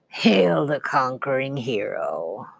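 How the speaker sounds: low, slow evil voice; a menacing voice from a nightmare. A narrator's reading. Evil voice greets the hero in a dream, slow and low. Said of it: "The evil voice began to laugh".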